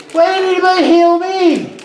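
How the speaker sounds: squeaky sprite pleading; pixie whine. Acted. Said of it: "a little voice".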